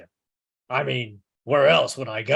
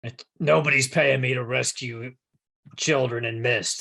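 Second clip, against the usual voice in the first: normal talking voice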